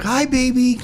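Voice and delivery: sweet talk to a dog. Retold or imitated. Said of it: "I'll say to my brother's dog".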